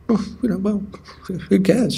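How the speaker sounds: high, airy shrug of a voice; mock indifference. Acts out indifferent border officials.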